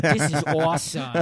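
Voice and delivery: imitating the therapist gushing; mock enthusiasm. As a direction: oversell it like a fake fan. Mocking the therapist's fake enthusiasm.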